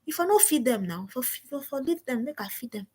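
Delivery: lightly put-on Nigerian Pidgin complaint; a touch of Nigerian Pidgin whining. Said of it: "I spoke pidgin".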